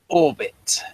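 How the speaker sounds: normal talking voice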